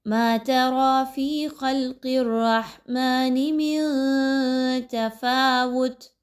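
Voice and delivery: slow melodic tajweed chant; Quran recitation. Shown as a demonstration. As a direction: recite each phrase slowly and drawn out. Recites the next verse. (آہستہ ترتیل سے تلاوت)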